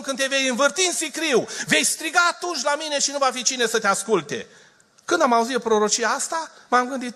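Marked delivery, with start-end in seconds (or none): 0.0-4.5 higher, ringing prophetic proclamation; prophetic call. Retold or imitated. The prophet calling out to the man, in a raised prophetic voice. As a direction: proclaim as the voice of the Lord. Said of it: "zice Domnul".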